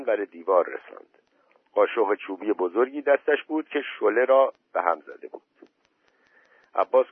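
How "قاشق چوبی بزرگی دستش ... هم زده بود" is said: ordinary delivery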